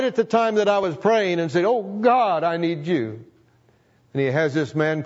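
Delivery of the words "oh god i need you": pleading prayer. Retold or imitated. Quoting his own prayer again.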